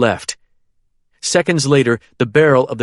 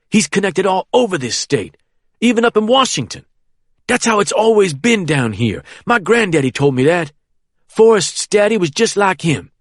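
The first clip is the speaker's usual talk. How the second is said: put-on boastful young man; 8 semitones higher, 3 dB louder than usual. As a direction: brag about the colonel's power. Harold boasts, taunting.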